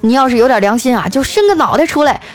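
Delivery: hammed-up indignant calling up from downstairs; mock angry shout. Car owner yells up at whoever dropped the flowerpot, mock angry shout. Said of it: "楼下开豪车那男的大声喊道".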